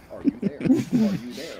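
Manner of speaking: glitching robot. Retold or imitated. Teasing his friend by mimicking his stuck robot question. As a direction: drag it out like a machine stuck on one question. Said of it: "like a robot stuck on a question".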